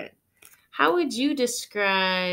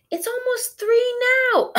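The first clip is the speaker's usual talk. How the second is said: much higher, louder urging; urgent Holly; 8 dB louder than usual. Acted. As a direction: hurry her along.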